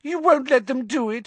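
high squeaky grovelling; obsequious. Acted. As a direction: fawn and beg, high-pitched. Pettigrew begs.

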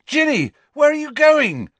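high, loud call after someone; yelling. A narrator's reading. Player calling to teammate speeding past him, high yell. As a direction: shout after her as she flies off. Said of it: "yelled Harry".

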